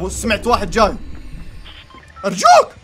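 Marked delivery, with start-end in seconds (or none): 2.2-2.7 pleading. Real, not acted. Gamer tense moment.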